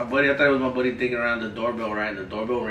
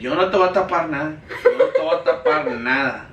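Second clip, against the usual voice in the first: mom's retort; 5 semitones higher, 9 dB louder than usual. Retold or imitated. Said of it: "She's like".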